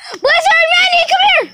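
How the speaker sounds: very high, loud shout; calling dogs. Real, not acted. Owner calling Blizzard and Manny, high shouted call. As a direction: holler for both dogs to come.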